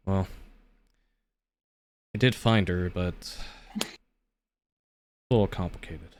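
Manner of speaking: somber. Acted. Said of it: "he kinda does get a little somber".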